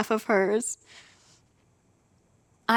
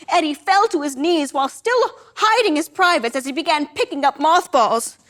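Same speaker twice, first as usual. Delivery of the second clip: plain reading voice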